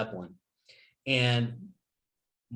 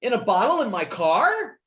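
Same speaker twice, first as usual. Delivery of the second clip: outraged yell; 11 semitones higher than usual. Retold or imitated. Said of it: "And he goes".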